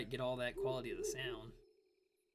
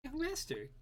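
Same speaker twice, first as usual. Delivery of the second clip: very high nagging call; high servant call; 12 semitones higher, slower than usual. Acted. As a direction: call the young master, squeaky. Gremio calling again.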